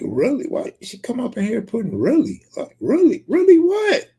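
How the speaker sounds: high, rising, disbelieving really; incredulous high-pitched mimicry of her; mock outrage at the chat. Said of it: "I was just like".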